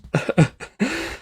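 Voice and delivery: a bright, high burst of laughter; high embarrassed laugh. Real, not acted. Podcast host laughing off her own tears. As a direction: laugh it off, embarrassed at getting emotional.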